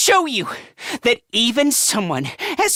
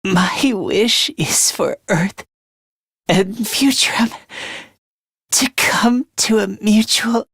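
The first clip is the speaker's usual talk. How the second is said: dying wish; 6 semitones lower than usual. Acted.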